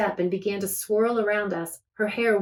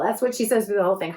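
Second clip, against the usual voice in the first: plain reading voice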